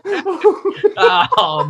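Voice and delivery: laughing. Real, not acted. Host laughing at guest.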